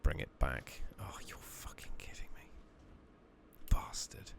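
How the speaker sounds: ordinary delivery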